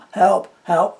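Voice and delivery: loud, high re-enacted yell; retold cry for help. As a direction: call out for help, reliving it. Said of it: "I started screaming for help".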